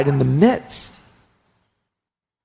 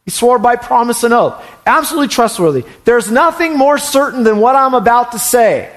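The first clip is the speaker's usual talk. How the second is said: fervent preaching; 7 semitones higher than usual. Acted. Preaching at full force.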